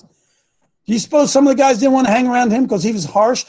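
ordinary delivery

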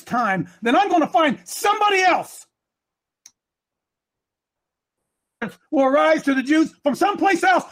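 high-pitched, driving sermon cadence; impassioned preaching. Real, not acted. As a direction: build the charge name by name, urgently. Preaches at full force.

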